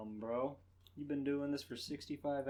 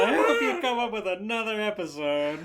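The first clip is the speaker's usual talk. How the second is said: laughing; 7 semitones higher, 15 dB louder than usual. Real, not acted. Friend cracking up.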